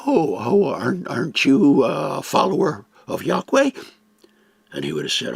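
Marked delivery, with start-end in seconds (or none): 0.1-3.7 surprised questioner. Retold or imitated. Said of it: "might have walked up to him and said".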